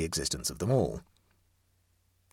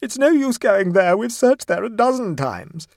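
high, loud warning cry, slowing at the end; called-out warning; 14 semitones higher, 9 dB louder than usual. A narrator's reading. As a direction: call the warning after someone, pitched way up and loud. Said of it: "followed by Missus Mumby's warning".

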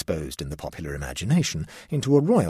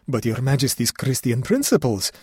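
bewildered exclamation; 5 semitones higher, 5 dB louder than usual. Acted. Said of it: "exclaimed the bewildered chamberlain".

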